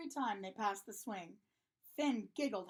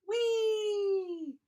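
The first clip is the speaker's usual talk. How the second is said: long, high, loud "wheee"; drawn-out high whoop, sliding slightly down; gleeful whee; 9 semitones higher, 9 dB louder than usual. A narrator's reading. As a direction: squeal as if launched through the air. Seesaw launches the characters upward.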